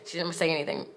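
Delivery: quiet, low-key aside; hushed disbelief. Real, not acted. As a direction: let it drop almost under your breath. Repeats the line, deflated then emphatic.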